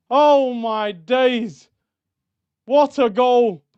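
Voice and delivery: drawn-out awed "Oh" then a loud "What"; overwhelmed fan still buzzing; stunned delight. Real, not acted. Reacting to the winning goal.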